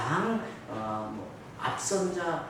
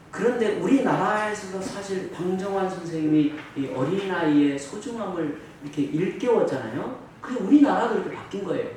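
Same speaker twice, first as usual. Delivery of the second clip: ordinary talk, nothing marked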